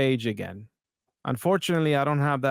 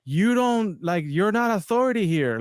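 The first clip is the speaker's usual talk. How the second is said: retold heckle; 7 semitones higher than usual. Audience member challenging the lead pastor from the crowd, mild mock yell. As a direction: imitate a protester calling out, voice lifted. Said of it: "audience member that clearly yells out".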